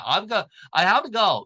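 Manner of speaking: American accent, "have to" blurred together. Shown as a demonstration. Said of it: "액센트가 미국 영어에 별로 없기".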